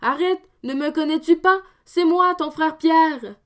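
high, loud desperate plea; Pierre pleading. Acted. Pierre under blows pleading with Dominique, high plea. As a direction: make him recognise you, fast.

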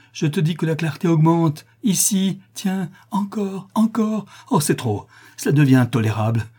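high, pained insistence, then dropping; growing suffering. A narrator's reading. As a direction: cry out as the light becomes unbearable. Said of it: "avec une expression de souffrance croissante".

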